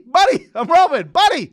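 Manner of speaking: very high, loud mock calls; insistent pretend player's shout; calling out on court. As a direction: keep yelling for the pass, hamming it up. Keeps calling for the ball.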